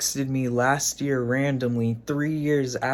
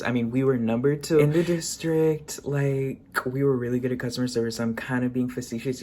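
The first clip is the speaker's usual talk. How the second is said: normal talking voice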